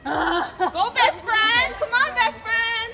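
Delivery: loud encouraging cheer. Real, not acted. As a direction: shout support up to her. Cheering friend on the ropes.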